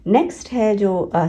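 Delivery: ordinary talk, nothing marked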